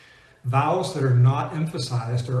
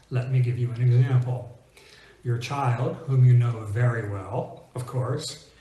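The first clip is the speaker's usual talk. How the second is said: ordinary talk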